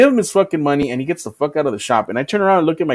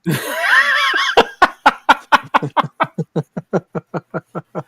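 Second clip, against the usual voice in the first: can't stop laughing at the joke; long laughing fit; 9 semitones higher than usual. Real, not acted. Laughs long at a friend's "sir" joke, cracking up.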